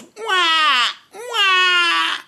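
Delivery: very high, loud mock baby wail; baby crying wah. Host mimics a crying baby, high mock wail. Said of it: "the baby that goes".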